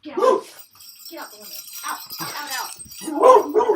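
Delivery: loud, high yells to shoo the dog; shouting at dog. Real, not acted. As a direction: order the dog out the window, loudly. Shooing dog out.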